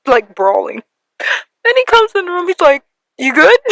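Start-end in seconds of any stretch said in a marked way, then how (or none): 1.6-3.6 high, teary laugh breaking in; laughing and crying at once; laughing through tears. Real, not acted. Telling how her brother found her crying, laughing through tears.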